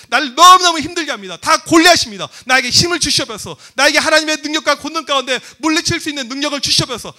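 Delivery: loud, urgent acted prayer; crying out in prayer. Student begging God for strength, loud pleading prayer. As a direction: raise your voice and plead with God. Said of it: "하나님 앞에 기도하란 말이에요".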